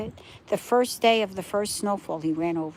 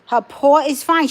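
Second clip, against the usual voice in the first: normal talking voice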